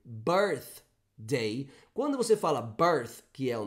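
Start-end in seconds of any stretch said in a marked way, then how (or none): 0.1-1.7 "birthday" with a clear unvoiced TH; careful birthday. Shown as a demonstration. Teacher models the word the student asked about. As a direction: stop on the TH in "birthday". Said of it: "A palavrinha em questão".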